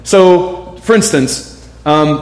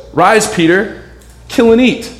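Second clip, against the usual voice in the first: raised, commanding voice of God speaking to Peter; voice of the Lord; 6 semitones higher than usual. Acted. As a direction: proclaim it as the Lord, slightly higher and firmer. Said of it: "he hears this voice that says".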